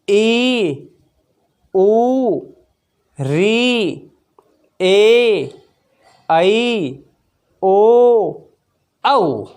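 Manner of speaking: vowels drawn out with a pause; held vowels. Shown as a demonstration. Teacher to class, vowels drawn out. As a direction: stretch each vowel a little. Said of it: "देखिए आ".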